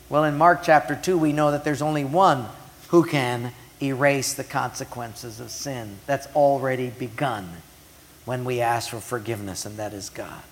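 ordinary talk

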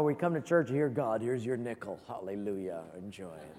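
low, fading smug churchgoer, dropping to a hush; mock smug charity. Pastor mocking smug churchgoer tossing homeless man a nickel, low and fading. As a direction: sneer it out like a self-satisfied giver, trailing off.

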